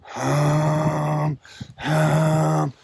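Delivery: long steady hum; humming. Shown as a demonstration. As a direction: hold a straight even hum. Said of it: "Get the hum".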